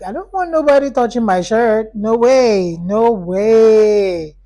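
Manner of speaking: acted-out firm, loud refusal; emphatic refusal. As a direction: refuse outright, drawing out the last "no way".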